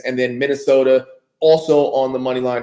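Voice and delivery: normal talking voice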